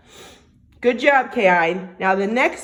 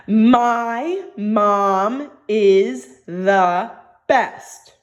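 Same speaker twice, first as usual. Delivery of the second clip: each word spaced far apart, very slow; very slow dictation; 4 dB louder, much slower than usual. Shown as a demonstration. Teacher to young students, dictating the new sentence slowly. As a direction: dictate it slowly so children can write. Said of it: "Are you guys ready?".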